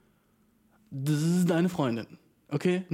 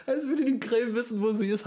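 laughing; 5 semitones higher than usual. Real, not acted. Laughing incredulous.

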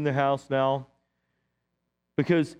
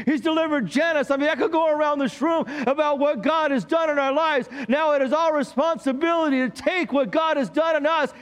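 preaching at full force; 8 semitones higher than usual. Acted. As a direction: preach it out to the room with fire. Pastor preaching to the congregation.

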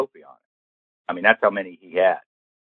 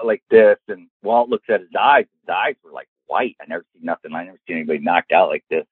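usual speaking voice

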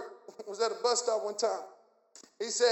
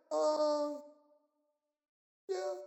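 soft, hesitant yeah after a long pause; stall, then agree without conviction; unsure, noncommittal mumble; doubtful agreement; 4 semitones higher, 5 dB quieter than usual. Acted. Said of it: "he said it with doubt".

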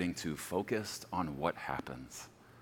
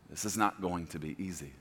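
ordinary talk, nothing marked

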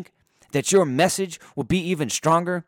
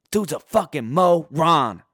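normal talking voice

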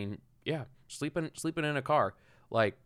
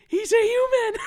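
squeaky, much higher and louder mock outburst; mock squeal; 11 dB louder than usual. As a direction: squeal it out in a silly high voice. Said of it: "I'm like".

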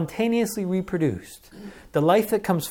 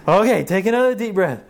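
coaxing with a laugh; 5 semitones higher, 6 dB louder than usual. Retold or imitated. Parent coaxing crying child.

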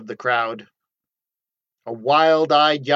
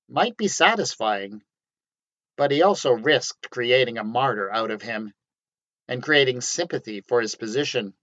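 usual speaking voice

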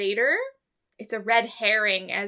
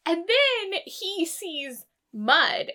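usual speaking voice

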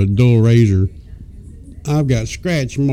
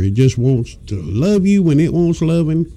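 normal talking voice

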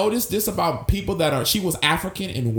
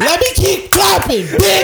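high, loud, punched word by word; shouted emphasis; 6 dB louder than usual. Retold or imitated. Imitating a mad woman clapping for emphasis, shouting. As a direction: yell it as if clapping on each word. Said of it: "emphasis. Always emphasis".

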